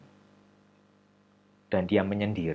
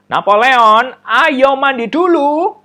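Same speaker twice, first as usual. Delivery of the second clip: mother calling; 14 semitones higher, 15 dB louder, slower than usual. Acted. Mother calling child to bathe.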